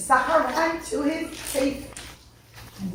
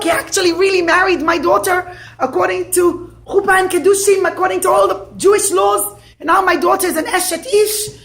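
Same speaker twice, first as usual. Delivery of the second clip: loud, higher angry rant; furious complaint; 5 semitones higher, 7 dB louder than usual. Acted. Father storming into the rabbi's office, loud angry rant. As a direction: storm in and vent.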